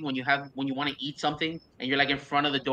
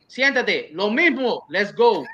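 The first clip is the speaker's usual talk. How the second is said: lightly voiced diner regular's waitress, higher and louder; raised-voice imitation of a familiar server taking charge; imitating brisk server; 5 semitones higher, 6 dB louder, much slower than usual. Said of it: "Lily just goes".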